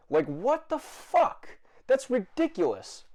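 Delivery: high, slow disbelieving outburst; exasperated outrage. Real, not acted. Vlogger baffled by rude moviegoers, high exasperated outburst. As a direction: stretch it out in angry disbelief.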